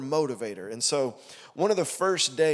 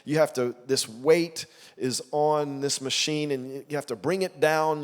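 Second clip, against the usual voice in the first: ordinary delivery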